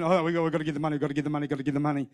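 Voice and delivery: low, quiet repetitive nagging; mock nagging under the breath; imitated nagging. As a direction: mimic a wife going on and on. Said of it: "Raquel kept saying".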